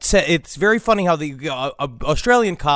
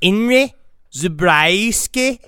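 put-on Australian accent; 2 semitones higher than usual. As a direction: announce the name in hammed-up Australian. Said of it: "I don't remember Henry being Australian".

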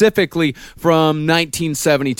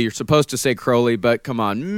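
ordinary talk, nothing marked

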